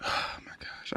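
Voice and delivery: whispered disbelief; real gutted whisper; stunned whisper. Real, not acted. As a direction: breathe it out, crushed. Whispering after losing fish.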